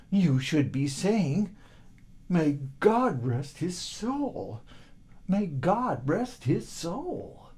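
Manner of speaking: very slow, hushed solemn prayer; slow it down like a mourner's blessing. Acted.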